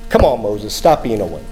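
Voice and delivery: low, mildly impatient scolding; dropped-pitch mock reproof; imagined impatient listener. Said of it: "if you're thinking".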